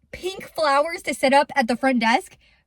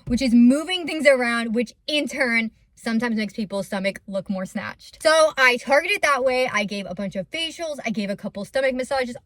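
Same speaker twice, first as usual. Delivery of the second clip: normal talking voice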